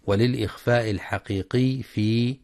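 ordinary talk

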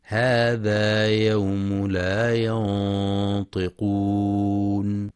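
very slow chant with a long nasal hum; Quran recitation. Shown as a demonstration. Teacher repeating the verse in chant, very slow. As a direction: stretch it out and hum the نون. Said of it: "ينتبه القارئ لتفخيم غنة الإخفاء".

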